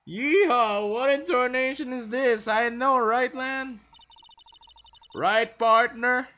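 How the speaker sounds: mock cowboy. Hammed-up cowboy exclamation.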